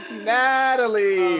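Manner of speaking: Natalie stretched out slow and high in disbelief; exasperated drawn-out name. Real, not acted. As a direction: groan her name like she can't believe it.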